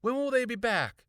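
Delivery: high, loud rapid-fire questions; frantic questioning. A narrator's reading. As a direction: demand answers urgently. Frantic girl questioning her brother.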